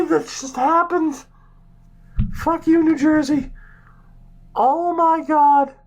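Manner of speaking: high-pitched, stumbling protest; astonished disbelief. Real, not acted. Streamer insisting it cannot have happened, high stunned voice. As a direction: refuse to believe it, pitch stuck up high.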